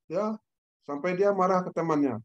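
ordinary delivery

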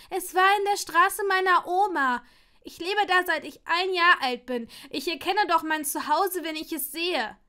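high, loud and urgent; upset insisting. Acted. Neville insisting to a doubting Draco, loud and high. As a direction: insist he knows his own home.

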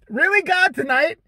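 loud, high cry of disbelief; exasperated protest. Acted. Man complains to God about tonight's bad timing, exasperated. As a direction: wail it up at the heavens in mock outrage.

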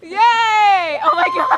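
high squealing cheers; excited cheering. Real, not acted. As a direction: squeal with delight. Daughter jumped in.

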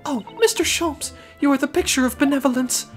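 high-pitched refined lady. Acted. Client flatters detective.

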